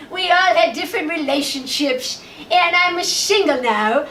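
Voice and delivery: hammed-up diva voice with a lisp, shingle for single; a fading star lisping her way through a cabaret patter. Singer thanking the front row, mock diva lisp. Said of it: "I heard her talking, she said".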